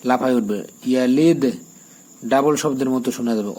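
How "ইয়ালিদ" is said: ইয়ালিদ with a bouncing echo on the final দ; ইয়ালিদ with a popping end; qalqala on yalid. Shown as a demonstration. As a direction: make the দ in ইয়ালিদ jump. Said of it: "এখানে কলকলা করবেন".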